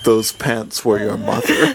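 low, slow deadpan father; Gendo. Acted. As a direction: reveal it low, slow and mock-solemn. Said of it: "And he's like".

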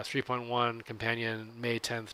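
usual speaking voice

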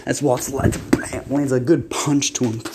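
ordinary delivery

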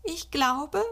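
mother playing along with a squeak; squeaky. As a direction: peep it like a shy little child. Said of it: "piepst Mutter".

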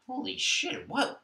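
surprised. Retold or imitated. Retold sudden realization.

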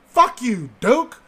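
a burst of mock outrage; loud outburst. Real, not acted. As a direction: blurt it out, fed up and amused. Outburst at the friend's claim.

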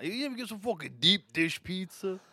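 high, exaggerated Chicago accent. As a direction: ham up a Chicago guy. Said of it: "cool Chicago accent".

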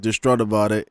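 ordinary delivery

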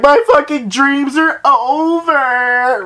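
high, slow, hammed-up tearful whine; mock despair. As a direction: wail it like a drunk tragedy. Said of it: "I'm like".

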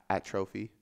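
atrophy said at-TROH-fee, softly; long TROH in atrophy; alternate pronunciation. Shown as a demonstration. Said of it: "I usually say atrophy".

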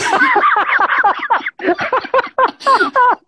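loud high burst of laughter; big laugh. Real, not acted. Laughing at her own story.

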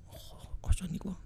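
hushed, voiceless gasp of awe; awed whisper. Retold or imitated. Man as girl reaches his table, awed whisper. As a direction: whisper it under your breath.

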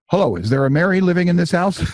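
high, loud, rushed question; creepy caller. Retold or imitated. Nervous caller asking for his birth mother, creepy blurt. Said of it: "I could tell I sounded creepy".

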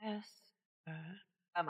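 ordinary talk

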